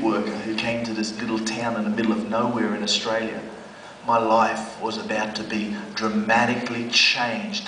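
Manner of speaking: low, soft, solemn build-up; preacher foreshadowing his life change, solemn; dramatic storytelling. A narrator's reading.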